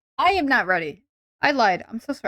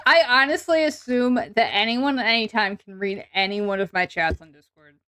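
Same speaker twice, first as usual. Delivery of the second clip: ordinary talk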